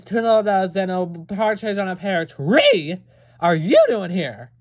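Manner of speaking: slow, loud, building list; mock-epic rant. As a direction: ham it up like a grand curse. Mock-grand escalating curse.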